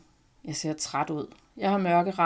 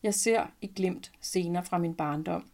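ordinary delivery

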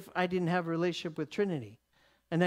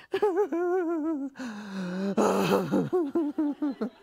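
long, high, sobbing wail; mock crying; 8 semitones higher, 3 dB louder than usual. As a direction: imitate bawling over someone's shoulder. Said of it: "why I'm crying".

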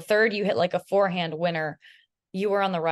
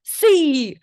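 triumphant shout; 9 semitones higher, 11 dB louder than usual. Retold or imitated. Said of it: "she goes".

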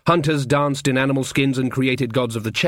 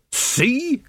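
exasperated; 12 semitones higher than usual. Acted. Nanny vindicated, exasperated.

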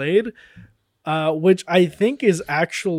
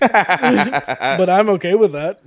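laughing; 4 semitones higher, 6 dB louder, much slower than usual. Real, not acted. Laughs after admitting weak accent.

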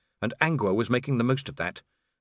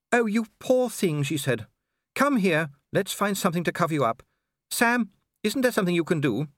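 motherly Sybil; 8 semitones higher than usual. A narrator's reading. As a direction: fuss over the poor girl. Sybil comforts Angua.

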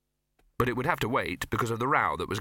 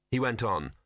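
plain reading voice

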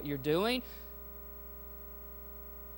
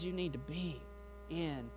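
softer and lower, trailing away; hushed, tender voice of God; 3 semitones lower, 11 dB quieter than usual. Acted. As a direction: speak it gently, like a quiet call. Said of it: "his voice says".

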